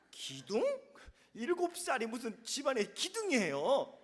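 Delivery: usual speaking voice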